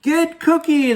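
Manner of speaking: very high, loud attack yell; shout. Acted. Bad boys leap at the cookie, yelling high and loud. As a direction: pounce and yell it. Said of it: "they shouted".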